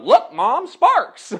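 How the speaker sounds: put-on high, loud, thrilled kid shout; excited little boy. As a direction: show mom your amazing discovery. Little boy showing mom, retold.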